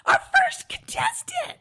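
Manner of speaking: very high, loud cheer, drawn out slowly; cheering crowd. Retold or imitated. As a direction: cheer like a crowd welcoming her. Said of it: "And they were like".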